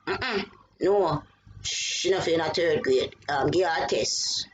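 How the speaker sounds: mom's Kingston Jamaican patois, low and firm; lightly voiced Jamaican mother refusing; Jamaican accent. Mother tells the school in Jamaican accent. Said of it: "my mom don't really talk like that, but she's from Kingston".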